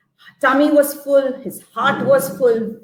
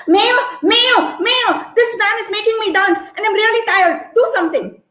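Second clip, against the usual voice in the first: higher, frantic begging; pleading landlord; 9 semitones higher than usual. Acted. Landlord begging the mayor, higher frantic plea. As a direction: beg the mayor for help.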